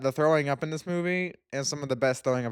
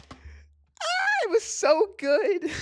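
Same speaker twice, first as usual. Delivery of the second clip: squealed very high, slow and stretched out; overwhelmed fan squeals about a favourite movie; gushing squeal; 3 dB louder, much slower than usual. Real, not acted. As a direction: melt into a high, swooning gush. Gushing over Edward Scissorhands.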